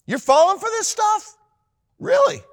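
very high, loud disbelief; incredulous mock. Mocking the gullible, very high incredulous.

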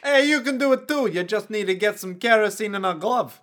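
high, loud hammed-up thug; mock mook voice. Mook urging his pal to try the trick, hammed-up voice. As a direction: egg a pal on as a cartoonish mook.

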